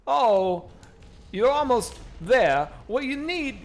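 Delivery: rough celebrity impression, high and halting; Christopher Walken. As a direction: attempt a Walken, drawn out with odd pauses. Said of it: "my Hawkins, no, no fucking, no walk in the park".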